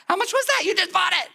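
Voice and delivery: very high-pitched wife losing her patience; hammed-up shrill demand; mock exasperated shriek. Wife demanding the golf bag's price, mock exasperated. Wife explodes in acted quarrel.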